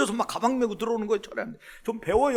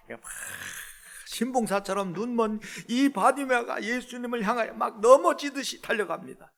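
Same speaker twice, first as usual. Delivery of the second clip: ordinary delivery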